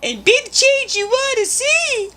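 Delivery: high, sing-song fake guru voice; mock inspirational. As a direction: deliver it like a cheesy motivational poster. Mock inspirational line, then "just joking.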